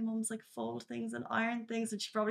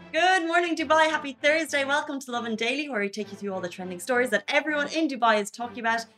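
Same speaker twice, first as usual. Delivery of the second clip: excited host; 6 semitones higher, 6 dB louder than usual. Acted. Upbeat show opener greeting listeners.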